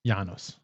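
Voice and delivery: "Janus" said as YAH-noos; Roman pronunciation. Shown as a demonstration. Host guesses how a Roman says the name, "Janus" as YAH-noos. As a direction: Latin "y" sound opening "Janus", open "ah". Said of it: "Or if you're a Roman, maybe".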